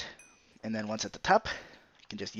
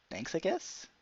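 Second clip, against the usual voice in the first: unsure, rising thanks; begrudging thanks; 6 semitones higher, 5 dB quieter than usual. Real, not acted. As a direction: thank them, half puzzled. Reading a viewer's odd chat compliment.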